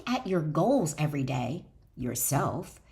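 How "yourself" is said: ordinary delivery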